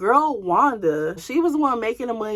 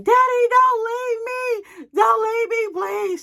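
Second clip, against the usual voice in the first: high, loud, wailing mock sob; hammed-up begging through tears; crying plea; 4 dB louder, slower than usual. Imitating the pregnant girlfriend begging him to stay, crying loudly. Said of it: "going crazy and saying".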